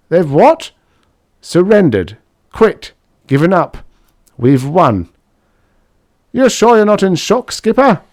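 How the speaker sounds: high, loud incredulous engineer; stunned disbelief. Acted. Sandoval stunned by surrender.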